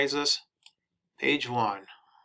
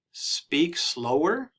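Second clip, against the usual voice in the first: slow teaching read; 6 semitones higher, 4 dB louder, much slower than usual. Shown as a demonstration. Teaching phrase, drawn out.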